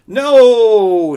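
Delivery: very high, very loud no; high panicked shout. Acted. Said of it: "shouted Coyote".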